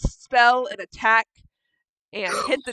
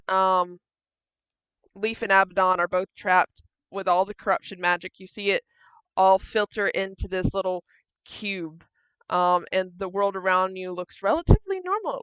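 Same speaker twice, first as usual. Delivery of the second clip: ordinary talk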